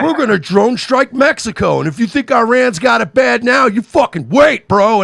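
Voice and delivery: mock war-hawk campaign speech; mock bloodthirsty candidate. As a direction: ham up a warmongering politician. Mocking DeSantis campaign rhetoric.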